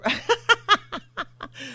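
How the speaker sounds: high giggle over a flubbed name; embarrassed laugh. Real, not acted. Host laughing at mispronouncing a name, giggle. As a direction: laugh it off, tickled.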